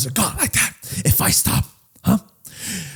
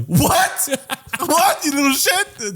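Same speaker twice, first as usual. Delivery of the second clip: much higher, laughing shocked yelp; hammed-up shocked shout; mock outrage; 10 semitones higher, much slower than usual. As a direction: act scandalized at the kid.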